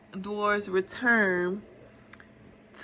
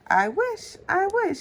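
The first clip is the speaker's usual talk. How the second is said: much higher, light wishing voice; princess wish; 12 semitones higher than usual. A narrator's reading. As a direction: float it up like a dreaming girl. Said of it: "she whispered".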